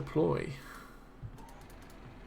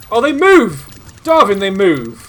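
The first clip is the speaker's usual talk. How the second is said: loud, much higher cry of surprise; amazed shout; 4 semitones higher, 10 dB louder than usual. Real, not acted. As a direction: shout it in sudden delighted surprise. Surprised the models move.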